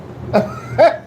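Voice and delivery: a high breathy burst of laughter. Real, not acted. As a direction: crack up laughing at the bot. Laughs at Nightbot acting a fool.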